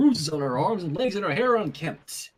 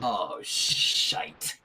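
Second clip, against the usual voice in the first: muttered curse; 11 semitones lower, 5 dB quieter than usual. Real, not acted. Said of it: "What the hell happened?".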